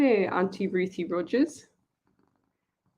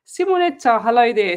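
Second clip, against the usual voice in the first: bright, loud, high hello to a regular; excited greeting; 3 semitones higher, 7 dB louder than usual. Real, not acted. Host warmly hails a regular viewer on the livestream, excited. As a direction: call the name out with real delight.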